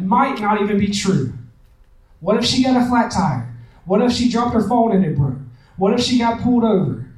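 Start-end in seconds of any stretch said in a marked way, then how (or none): none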